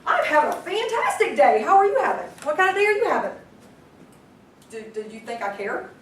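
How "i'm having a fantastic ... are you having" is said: higher and louder, bursting with enthusiasm; high-energy caller. Shown as a demonstration. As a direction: sell it like a fantastic day. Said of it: "But if somebody calls you, like".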